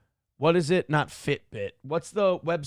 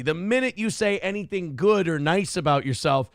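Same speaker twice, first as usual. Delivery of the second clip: ordinary talk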